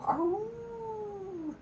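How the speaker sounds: high, long howl like a dog. Shown as a demonstration. Showing the crowd how to howl, high dog howl. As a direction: throw your head back and howl. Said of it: "act like dogs and go".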